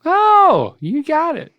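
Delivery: high, loud delighted oh; delighted surprise. Real, not acted. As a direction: cheer the right answer. Delighted reaction to correct answer.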